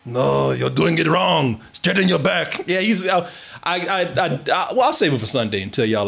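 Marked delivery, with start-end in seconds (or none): 0.1-2.6 lightly voiced old Turkish coach, drawn out; Turkish trainer. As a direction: slowly scold like an old gym trainer, lightly imitated. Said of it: "kinda like the Rocky's trainer in a way, except Turkish".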